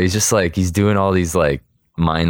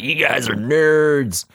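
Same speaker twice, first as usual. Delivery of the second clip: high, drawn-out taunt; slow sing-out heckle, pitched up; mocking jeer; 9 semitones higher, slower than usual. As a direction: imitate a passing bully, stretching the insult. Hot rod driver jeers at them.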